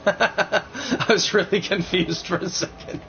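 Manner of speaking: laughing while talking, high; giggling through the words. Real, not acted. The console's voice makes him laugh.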